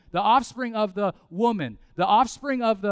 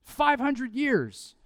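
ordinary delivery

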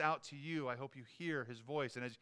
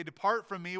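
raised, loud pronouncement; Jesus in judgment; 5 semitones higher, 7 dB louder than usual. Acted. Jesus dismissing false followers at judgment, raised stern pronouncement. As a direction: send them away with a louder, higher voice. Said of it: "He'll say".

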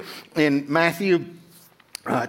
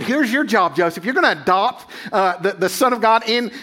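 eager angel; 6 semitones higher than usual. Retold or imitated. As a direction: play the angel urging Joseph in a dream, high and pressing. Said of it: "And he said".

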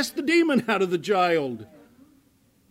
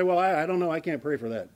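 reluctant minister; 5 semitones lower, 4 dB quieter than usual. Retold or imitated. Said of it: "and you say".

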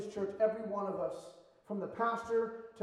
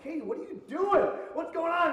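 calling out; 7 semitones higher, 6 dB louder than usual. Retold or imitated. Friends calling out to Katie.

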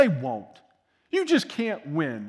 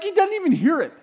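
ordinary delivery

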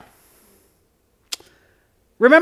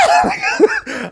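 burst of laughter. Real, not acted. Laughing at his own scream.

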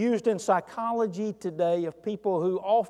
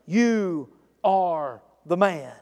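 each word slow and heavy, weight on "You"; slow accusing verdict; much slower than usual. Retold or imitated. Preacher quoting the prophet Nathan to King David, slow and grave. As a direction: deliver "You are the man" like a prophet's sentence. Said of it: "Nathan said and declared to the king".

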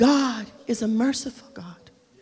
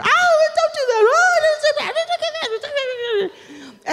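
much higher, louder shriek with laughter; mock panicked scream; 3 dB louder, much slower than usual. Wife screaming at husband's driving, hammed-up high shriek. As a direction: shriek like a terrified passenger, laughing through it. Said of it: "I was screaming bloody murder".

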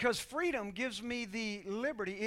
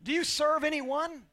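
ordinary talk

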